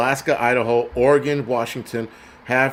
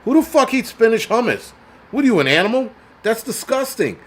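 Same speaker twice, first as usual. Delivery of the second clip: outraged disbelief; 9 semitones higher, 3 dB louder than usual. Real, not acted. Outraged rant about spinach hummus.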